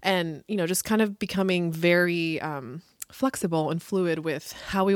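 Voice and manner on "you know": ordinary talk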